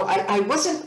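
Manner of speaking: normal talking voice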